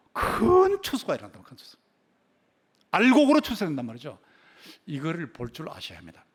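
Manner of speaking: ordinary talk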